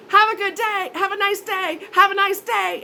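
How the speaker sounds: bright, high, dismissive farewell; high sing-song goodbye. Retold or imitated. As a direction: sing it sweetly while hanging up. Hanging up on him.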